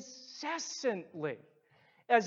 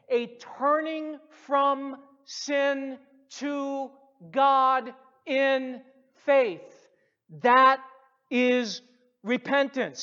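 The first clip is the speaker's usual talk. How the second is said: preaching emphasis; 5 semitones higher, 6 dB louder, slower than usual. Acted. Preacher hammering home repentance.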